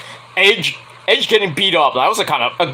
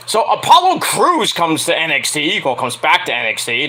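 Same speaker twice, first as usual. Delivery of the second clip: normal talking voice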